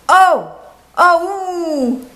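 put-on high, loud child yell; little boy yell. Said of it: "yelled the little boy".

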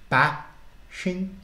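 Bashingford drawn out slowly, every sound stressed, rising; slow, stressing each sound. Acted. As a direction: taste Bashingford like a foreign word. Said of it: "he said slowly, stressing each sound".

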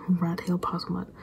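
ordinary talk

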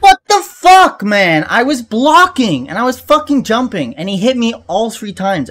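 high fast furious rant; raging gamer. Real, not acted. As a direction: rant it out in fury. Rage after death.

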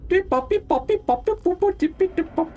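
high beeping robot noises; R2-D2 impression. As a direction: chirp the beeps like a little droid. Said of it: "my best R two D two impression".

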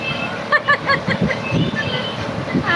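high burst of laughter. Real, not acted. Laughing at viewers' comments.

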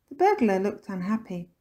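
ordinary delivery, nothing marked

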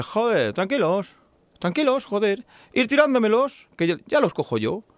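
brash high-pitched boast; cocky Basque man. Acted. Basque man shouting up to the trapped people, cocky and high. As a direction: call up reassuringly, full of swagger. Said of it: "uno que es de lado y dice".

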